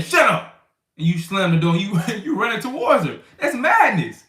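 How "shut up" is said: loud high snapping yell; angry yell. Retold or imitated. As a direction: bark it at her like a bully. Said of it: "you saying".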